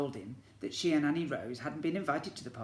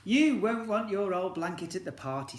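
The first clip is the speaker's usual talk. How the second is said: louder, higher mum voice; Mum coaxing; 6 semitones higher, 7 dB louder than usual. Acted. Mum tells Alfie to leave his blanket, coaxing. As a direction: coax him as Mum.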